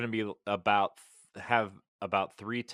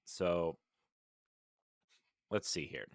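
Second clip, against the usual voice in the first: usual speaking voice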